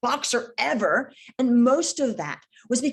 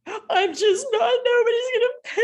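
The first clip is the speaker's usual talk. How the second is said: hammed-up weepy whine; mock crying; 12 semitones higher, 4 dB louder than usual. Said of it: "Stop, stop crying right now".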